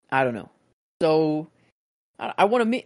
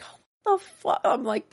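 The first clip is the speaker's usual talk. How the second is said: retold shock; 12 semitones higher, slower than usual. Own shocked reaction retold.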